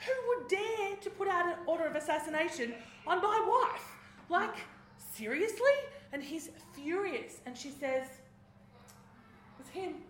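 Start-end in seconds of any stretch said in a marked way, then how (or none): 0.1-5.8 outraged king, higher and louder, building to a shout; furious king. Retold or imitated. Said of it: "And the king's furious". 8.1-10.0 breathless whisper, very slow; whispered accusation. Retold or imitated. Queen accuses Haman at dinner.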